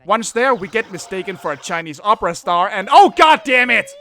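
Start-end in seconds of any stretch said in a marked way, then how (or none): 2.9-3.9 loud, high burst of dismay; exasperated outburst. Acted. Host sees another bad caricature, exasperated outburst. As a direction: break off and curse loudly.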